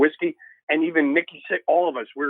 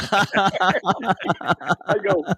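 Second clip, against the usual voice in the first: usual speaking voice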